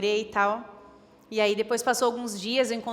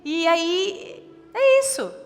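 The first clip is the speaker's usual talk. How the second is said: louder, higher preaching, driving each line home; fervent preaching; 6 semitones higher, 5 dB louder than usual. Acted. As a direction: preach it out with rising fire. Preaching builds to full force.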